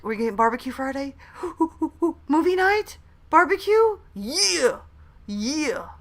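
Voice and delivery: hammed-up delighted squeal at movie night and barbecue; acted TikTok excitement. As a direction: build from a gasp to a giddy high cheer. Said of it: "a reference to one of my TikToks".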